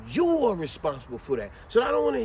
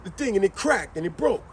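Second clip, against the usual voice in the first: high, louder pleading excuse; excuse-making friend; 6 semitones higher, 3 dB louder than usual. Retold or imitated. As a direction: talk your way out of it. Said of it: "He said".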